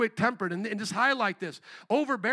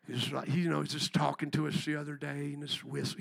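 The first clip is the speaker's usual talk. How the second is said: lightly imitated laid-back, low and soft voice; chill pastor's whistling talk; 8 semitones lower, 6 dB quieter than usual. Imitating a chill farmer-like pastor, low and soft. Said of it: "almost got like a little whistle when he talks".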